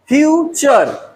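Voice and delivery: फ्यूचर split slowly into fyu... chur; slow two-beat फ्यूचर with a gap after fyu; syllable by syllable. Shown as a demonstration. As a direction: stretch फ्यूचर into two separate sounds. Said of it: "टू sound".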